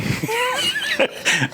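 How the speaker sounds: high-pitched laughter; high burst of amusement; amused laugh. Real, not acted. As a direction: laugh at your own story. Laughs at own retold apology.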